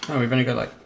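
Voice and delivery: usual speaking voice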